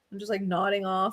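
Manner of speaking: ordinary delivery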